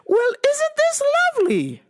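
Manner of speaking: very high sugary mock voice; mocking the lady. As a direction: sneer it in a fake sweet voice. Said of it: "And she said".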